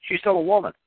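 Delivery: ordinary delivery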